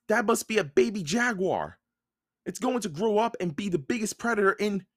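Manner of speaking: put-on high-pitched, insistent child's voice; boy character, warning. A narrator's reading. Jack warns Annie about the baby jaguar, high insistent voice. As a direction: explain the danger in a high kid voice.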